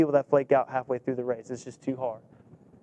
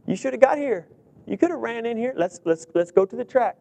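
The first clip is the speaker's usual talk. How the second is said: higher, mock-sweet coach; teasing coach; 6 semitones higher than usual. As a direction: tease the kids gently. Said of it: "I said".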